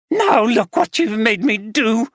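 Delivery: the betrayed wife letting rip; wife accusing husband of gambling, furious; angry wife. Acted. Drunk wife spills gin.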